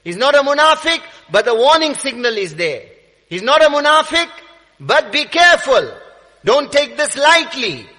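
loud, high sermon voice; urgent preached warning. Real, not acted. Preacher warning believers about hypocrisy, urgent and loud. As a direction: drive the warning home forcefully.

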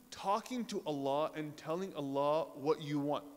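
normal talking voice

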